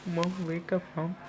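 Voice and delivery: high, soft pleading sob-voice; crying for mom. Acted. Izuku pleading over his dead mother, high and tearful. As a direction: beg her to wake up, voice high and breaking. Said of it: "Izuku started to cry".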